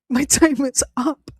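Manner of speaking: high, slow line through laughter; laughing. Real, not acted. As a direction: laugh through the retold last words. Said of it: "while I'm laughing".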